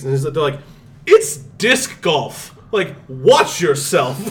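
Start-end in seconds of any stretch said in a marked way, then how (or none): 1.1-4.2 very slow, drawn-out snooty warning; hammed-up slow warning, laughing; snooty correction. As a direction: stretch every word, condescending. Frisbee golfers correcting.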